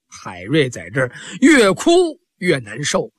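usual speaking voice

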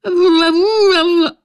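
high, slow, tearful no's; sobbing denial. Acted. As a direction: drag each no out through sobs. Wounded man sobbing denial. (哭着连声说不，拖长而高)

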